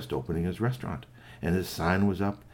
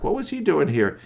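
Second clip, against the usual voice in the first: plain reading voice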